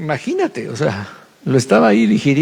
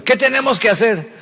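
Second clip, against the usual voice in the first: high, anxious plea; panicked sailor; 9 semitones higher than usual. Acted. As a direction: ask Jonah in a panic. Panicked sailors ask Jonah. (marinero asustado, voz aguda)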